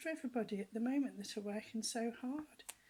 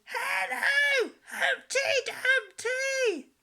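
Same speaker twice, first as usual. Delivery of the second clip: much higher, louder shout, slow at the end; little girl's shout; 12 dB louder, much slower than usual. Retold or imitated. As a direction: call out with all her might. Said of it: "She shouted".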